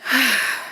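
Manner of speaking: audible exhaling sigh; demonstrated sigh. Shown as a demonstration. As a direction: let the breath out in a sigh. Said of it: "breathe in and sigh out".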